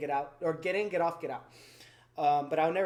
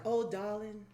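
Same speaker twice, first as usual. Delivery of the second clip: lightly put-on Southern lady's accent; Old South accent; 4 semitones higher, slower than usual. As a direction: imitate a Southern lady, slightly higher in pitch. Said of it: "She had the Old South accent".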